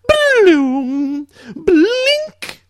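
drawn-out high vocal computer chimes; messenger chimes. Shown as a demonstration. Host recording chimes for listeners to isolate, high tones. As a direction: mimic alert tones cleanly, leaping up high. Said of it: "make it your own Windows Messenger chimes".

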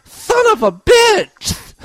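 slow, high, shrieked curse; screaming. Acted. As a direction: scream it out with a slight lisp. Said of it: "Molly screamed".

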